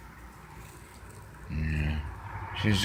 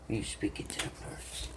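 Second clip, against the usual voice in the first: whisper; 2 semitones lower, 16 dB quieter than usual. Real, not acted. Whispering to the cat.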